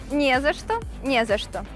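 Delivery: ordinary talk, nothing marked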